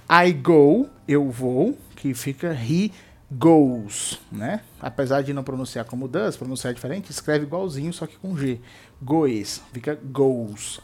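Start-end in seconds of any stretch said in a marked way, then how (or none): none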